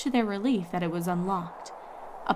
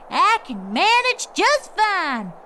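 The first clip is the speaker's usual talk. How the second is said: put-on slow, louder, much higher old mare; stubborn Granny Smith; 11 semitones higher, 6 dB louder, slower than usual. A narrator's reading. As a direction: insist slowly, proud old grandmother. Granny insists.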